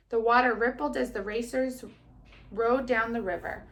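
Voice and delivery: put-on lively storybook reading to children; kindergarten teacher voice. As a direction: read it brightly to little kids. Teacher reads story aloud.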